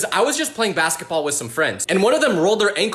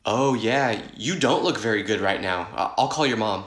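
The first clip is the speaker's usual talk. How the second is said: lower, softer worried teacher voice; a hint of a concerned teacher, dropped low; teacher's concern; 9 semitones lower, 3 dB quieter than usual. Teacher to pretend-sick pupil, concerned and hushed.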